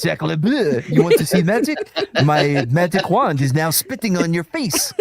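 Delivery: laughing French chef voice; hammed-up French magician; French accent. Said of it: "that got pretty French".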